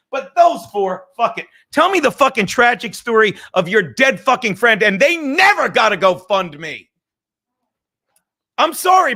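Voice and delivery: loud, heated rant; yelling. Real, not acted. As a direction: raise your voice and hammer the point. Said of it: "and I'm not yelling at you".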